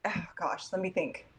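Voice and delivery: normal talking voice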